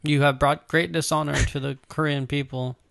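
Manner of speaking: ordinary talk, nothing marked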